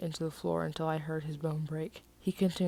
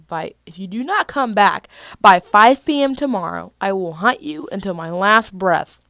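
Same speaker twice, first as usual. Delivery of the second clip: menacing threat; 3 semitones higher, 15 dB louder than usual. Acted. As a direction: threaten to hunt him down.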